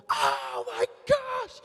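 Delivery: high, loud shouts of amazed joy; joyful eruption. Acted. The whole world erupting in joy at the risen champion, shouting. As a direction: cry out like a crowd that just saw the impossible. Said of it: "the whole world erupted in joy".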